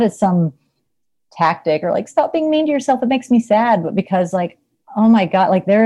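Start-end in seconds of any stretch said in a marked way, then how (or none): 2.1-3.8 high-pitched mock plea, run together; mock pleading. Mimics a pleading tactic.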